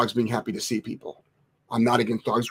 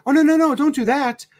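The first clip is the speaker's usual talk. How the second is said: mocking coddling owner; 9 semitones higher than usual. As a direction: lightly parody the owner soothing her dog. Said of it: "this is what people will suggest you do".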